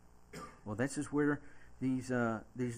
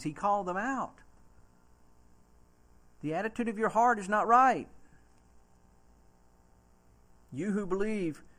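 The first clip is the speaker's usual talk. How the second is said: loud, high fiery preacher; pulpit-pounding sermon peak; preaching at full force; 6 semitones higher, 6 dB louder than usual. Acted. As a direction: build to a thundering call-out. Preaching climax on John's rebuke.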